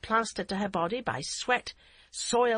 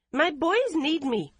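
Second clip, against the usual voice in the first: high, slow, raised voice; defiant mother; 9 semitones higher, 5 dB louder, slower than usual. A narrator's reading. Mother claiming her boys, slow and high. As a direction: Yum to her mother-in-law, high and insistent. Yum defying.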